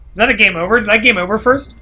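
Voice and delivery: ordinary delivery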